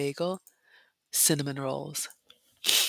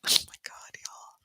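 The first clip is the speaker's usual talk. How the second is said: softer, whispered gasp of disbelief; awed whisper; 6 dB quieter than usual. Real, not acted. Whispered awe at croissant.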